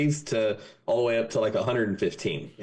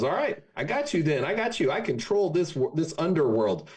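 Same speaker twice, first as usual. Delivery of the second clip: higher, playful threat; mock-menacing Devil; 6 semitones higher than usual. The Devil vowing to beat the Texans, higher mock threat. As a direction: promise them something worse.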